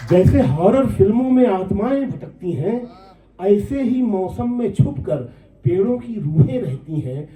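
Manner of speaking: high, carrying mushaira delivery of the verse; poem recitation. Acted. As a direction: lift the lines high, coming down at the close. Repeats verse, pitched up. (ऊँचे सुर में नज़्म पाठ)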